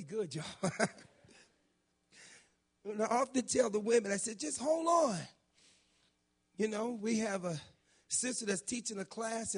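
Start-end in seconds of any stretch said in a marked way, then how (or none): 4.4-5.2 retold urging. Retold advice to single women.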